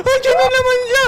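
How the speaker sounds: high-pitched frightened cry; panicked alarm. Real, not acted. Player warning friends it is there again, high panicked cry. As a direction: cry out that it's back.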